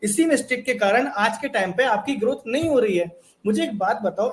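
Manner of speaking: usual speaking voice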